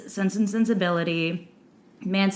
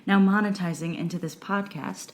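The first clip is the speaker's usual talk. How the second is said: sultry voice; 2 semitones lower than usual. Pretend. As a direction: purr it like a perfume ad. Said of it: "I really like the sultry voice you just moved into".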